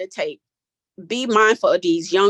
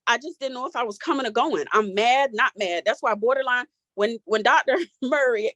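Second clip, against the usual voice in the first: ordinary delivery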